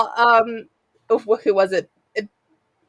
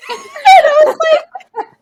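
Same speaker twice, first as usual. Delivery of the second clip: high squealing laugh through the words; laughing hard; 10 dB louder, slower than usual. Real, not acted. Laughing at the funny thirst tweet video. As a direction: crack up mid-sentence.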